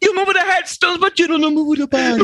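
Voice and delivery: high, hammed-up Italian accent; mock Italian man scolding over the bodies. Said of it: "How would an Italian man say that".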